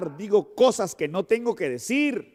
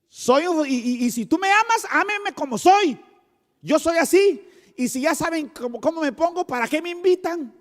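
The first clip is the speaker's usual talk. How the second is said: mock stubborn person; 10 semitones higher, 4 dB louder than usual.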